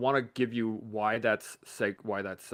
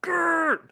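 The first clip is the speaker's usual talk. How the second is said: straining growl; 8 dB louder than usual. Retold or imitated.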